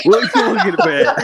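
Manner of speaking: high, loud laughing mock Cockney accent; a quick jokey Cockney accent through a laugh; badly done Cockney accent. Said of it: "do not ever do a Cockney accent".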